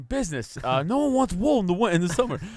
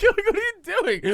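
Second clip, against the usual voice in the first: laughing. Acted. Laughing at dad's odd play.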